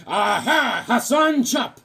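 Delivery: high, punchy attack shout; hammed-up high fighter yell; cartoon battle cry. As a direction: cry out your special attack. Voices a drawn fighter's attack cry.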